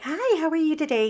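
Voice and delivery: high, bright-pitched hello; cheery greeting. Retold or imitated. Answering the young man in a high cheery voice. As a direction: greet him sweetly.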